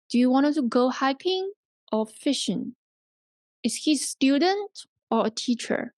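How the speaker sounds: "hiking" and "student" up, "fishing" and "teacher" down; rise-fall choice questions. Shown as a demonstration. Teacher models either-or questions, rise then fall. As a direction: lift the first option "hiking" or "student", drop on "fishing" or "teacher". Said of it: "either or questions".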